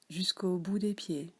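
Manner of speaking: ordinary talk, nothing marked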